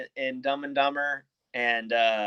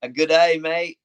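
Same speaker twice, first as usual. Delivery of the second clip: light attempt at an Aussie accent, raised pitch; 6 semitones higher, 6 dB louder, slower than usual. Shown as a demonstration. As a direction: have a go at sounding Australian. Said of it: "give me your best Aussie accent".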